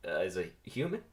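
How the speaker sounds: plain reading voice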